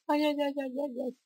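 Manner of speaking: very high, rapid string of yelps; high-pitched fretting. Real, not acted. Player in sudden trouble in the game, high and panicky. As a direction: fret in a high squeal.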